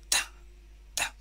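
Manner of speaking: T sounded as a short isolated "ta"; suono della T. Shown as a demonstration. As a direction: give the bare T sound, twice. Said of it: "se pronuncia ta".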